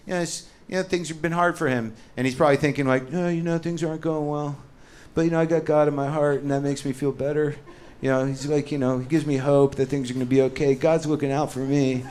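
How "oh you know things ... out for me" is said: lightly voiced hopeful guy; a hint of a man comforting himself; mild inner voice of a struggling dude; hopeful believer. Dude thinking hopeful thoughts.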